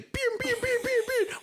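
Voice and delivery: squeaky high chicken squawk for a playful pew-pew chant; silly sky-high chicken voice with a laugh in it; crazy chicken voice. Retold or imitated. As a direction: go way up high like a frantic cartoon chicken. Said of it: "like crazy chicken".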